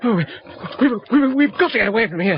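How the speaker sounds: high, stammering and rushed with fear; panicked. Acted. Friend urging Matthew to flee the wolf, panicked. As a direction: scramble the words out in terror.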